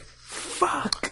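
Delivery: long voiceless curse; whispered dread. Retold or imitated. Friends retelling the cop's arrival, whispered dread. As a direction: whisper the swear in mock horror.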